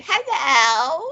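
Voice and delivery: put-on high childlike ghostly hello; small high child's voice; little girl hello.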